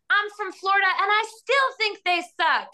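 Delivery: high shouted heckle; retold shout. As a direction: yell it at the field from the stands. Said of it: "I shouted".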